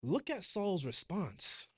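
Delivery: drawn-out, slow pointer for the flock; slow it right down to draw attention; slow emphasis. Shown as a demonstration. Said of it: "Check this out, yeah?".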